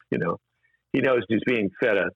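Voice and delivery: normal talking voice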